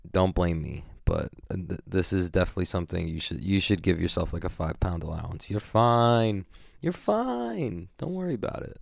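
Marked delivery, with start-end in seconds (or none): 5.5-8.8 squeaky reassuring sidekick character; a high, gentle mascot voice telling you you're fine; Captain Flavor voice. Pretend. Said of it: "Take it from Captain Flavor".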